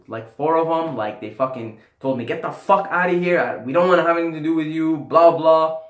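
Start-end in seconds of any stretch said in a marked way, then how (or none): 2.2-5.8 higher, louder, fast snotty dismissal; mock the girls telling him to get lost, rapid and shrill-free but loud; bitchy brush-off. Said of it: "Bitchiest attitude".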